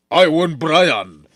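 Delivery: loud, slow, drawn-out delighted greeting; eager welcome. Acted. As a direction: hail him like a long-lost favourite. Father greets rich suitor eagerly. (napigsa ken nabannayat a naragsak a panangabrasa)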